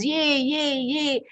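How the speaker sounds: put-on high, delighted cheering like a small boy; a child's happy yay, yay; excited child. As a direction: cheer like a thrilled little child. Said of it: "He says".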